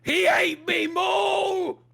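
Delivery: high, slow strained guard voice; as if his voice box was chewed; damaged guard voice. Acted. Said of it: "now I talk like this".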